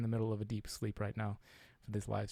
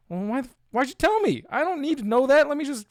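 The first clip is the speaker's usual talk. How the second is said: much higher, louder mock outrage; exasperated retort; 14 semitones higher, 10 dB louder than usual. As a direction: squeak out an indignant comeback. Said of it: "I was like".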